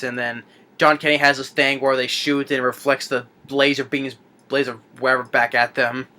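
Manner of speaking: ordinary talk, nothing marked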